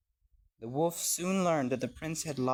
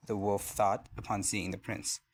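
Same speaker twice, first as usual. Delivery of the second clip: ordinary delivery, nothing marked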